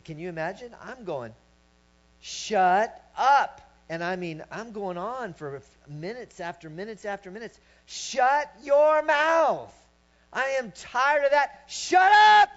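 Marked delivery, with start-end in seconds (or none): 2.2-3.6 loud, high "shut up", punched out; forceful command. Retold or imitated. Reenacts scolding his own thoughts. 7.8-12.5 high, full-force yell building to a peak; furious shout. Retold or imitated. Reenacts fighting off his thoughts.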